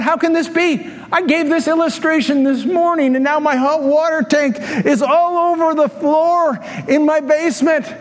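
mock aghast at a flooded basement; stunned disbelief. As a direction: sound amazed it really happened. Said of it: "stood there in complete disbelief".

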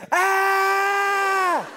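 long, high mock scream; hammed-up howl of stinging pain; imitated child's scream. Youngest son sprayed in the eyes, high mock scream.

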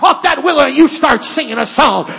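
rising from firm to shouted preaching; building to full-force preaching. Acted. Preacher to congregation, climbing into a shout. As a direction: build it up till you are shouting the gospel.

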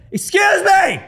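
high shouted excuse me; retold shout. Shouting at the man blocking his view. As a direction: call out sharply over the music. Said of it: "And so I went".

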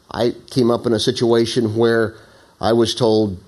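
ordinary delivery

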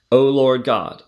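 usual speaking voice

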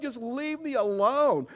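high, drawn-out mock whine; exasperated plea. Overwhelmed man to his pastors, whining exasperation. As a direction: stretch it into a comic wail.

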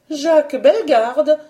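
Jacques Bellegarde echoed high and loud; surprised repeat. A narrator's reading. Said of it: "répétait madame Mauroy".

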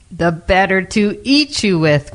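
slow, menacing growl; growling wolf. A narrator's reading. Wolf about to eat the girl, growling slowly. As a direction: draw out the threat as a growl. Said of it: "growled the wolf".

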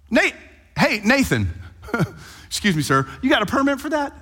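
high, loud, incredulous heckling; mock indignation. Preacher mock-scolding Nathan for forgiving, high incredulous protest. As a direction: challenge him like a snippy official. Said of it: "preacher mock-protesting Nathan".

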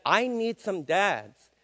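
"I need some dads" drawn out very slowly, stress on I; deliberate slow plea to the dads; slow pleading emphasis. Acted. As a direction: lean on I and slow right down. Preacher repeats his call to dads.

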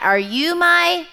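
high, loud, slow storybook chick; baby bird's question. Acted. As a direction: draw it out and leave it hanging for listeners to finish. Bird's question, left for audience to finish.

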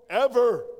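a high, loud single-word proclamation; shouted answer. Real, not acted. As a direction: belt it out like the peak of the sermon. Preacher's climactic answer.

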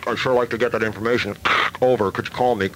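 radio call broken by static bursts; car radio voice. Acted. Caller asks the officer to call back, car radio voice. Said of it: "so I didn't talk over my car radio".

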